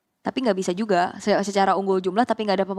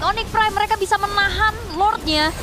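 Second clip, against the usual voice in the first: excited high co-caster recap; hyped recap of the chase; esports caster; 8 semitones higher than usual. Acted.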